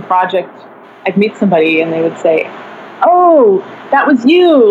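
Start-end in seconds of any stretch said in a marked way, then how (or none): 3.0-4.7 high, loud delighted "oh, that was you"; a stranger suddenly recognising the artist; surprised recognition. Retold or imitated. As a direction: imitate a stranger spotting you.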